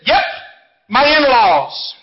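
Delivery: quick yep, then a drawn-out payoff; unflinching comeback. A narrator's reading. Wife answering husband's jab, unflinching comeback. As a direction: fire back without a second's thought. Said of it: "without flinching, without thinking".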